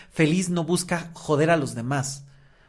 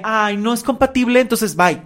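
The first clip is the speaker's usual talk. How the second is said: higher, louder mock brush-off; mock dismissal; 6 semitones higher, 8 dB louder than usual. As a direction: act out an exaggerated, quick-to-quit goodbye. Mimics giving up on the relationship.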